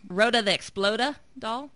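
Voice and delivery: put-on brash New York accent announcing. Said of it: "I have to use my, my, um, New York voice".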